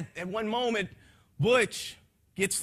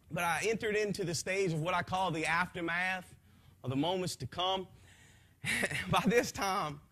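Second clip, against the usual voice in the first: ordinary talk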